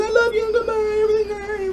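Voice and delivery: very high, wailing mimicry; imitating girlfriend. Man imitating girlfriend's loving voice message to him, high mimicry. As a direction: put on a squeaky, tearful-sounding voice.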